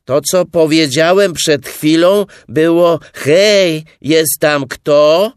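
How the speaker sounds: loud, high shout; high-pitched hollering; resounding call. A narrator's reading. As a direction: call it out at full voice into a hole. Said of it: "zawołał Puchatek donośnym głosem".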